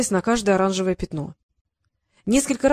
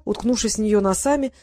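usual speaking voice

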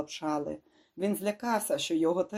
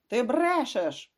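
higher, drawn-out angry bark; 7 semitones higher, 4 dB louder, slower than usual. A narrator's reading. Demarco calling the doctor a liar, barking angrily. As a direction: bark the accusation, stretched and raised. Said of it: "гаркнув Демарко".

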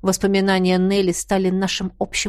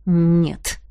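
through gritted teeth; 4 semitones lower than usual. Acted. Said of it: "процедил он сквозь зубы".

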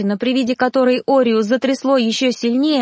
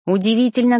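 plain reading voice

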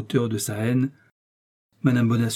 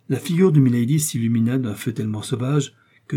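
ordinary delivery, nothing marked

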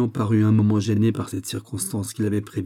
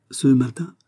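higher, louder startled question; increasingly agitated; 4 semitones higher, 6 dB louder than usual. A narrator's reading. Aramis reacts to Porthos's news, increasingly agitated. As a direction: ask it with rising agitation. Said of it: "de plus en plus agité".

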